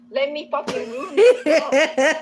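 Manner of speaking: a loud, slow, laughing take on his self-pity; hammed-up sneer at his self-pity, loud and slow; mock self-pity. Mocking the boyfriend's attitude to chat, loud hammed-up whine. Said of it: "his whole thing in life is just".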